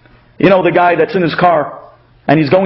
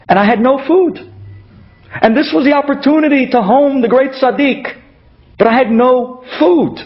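distressed; 7 semitones higher than usual. Acted. Woman recounts her distress.

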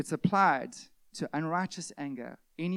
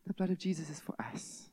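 whisper; 6 dB quieter than usual. Acted. Whispered emphasis.

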